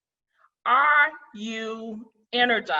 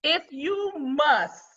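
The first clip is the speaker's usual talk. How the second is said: slow "If you must", leaning on must; deliberate slow emphasis on must; stress on must; 5 semitones higher, much slower than usual. Shown as a demonstration. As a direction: drag it out and weigh down must. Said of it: "I emphasize the word must".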